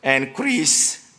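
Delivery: hammed-up French accent announcing nominees. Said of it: "I will maybe do some Spre-, French accent".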